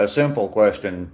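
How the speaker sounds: ordinary delivery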